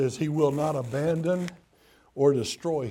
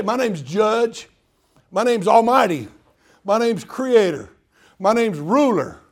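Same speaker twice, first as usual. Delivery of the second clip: imposing mock introduction; 5 semitones higher, 8 dB louder than usual. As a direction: declare each title like an intimidating ruler. Mock titles God doesn't use.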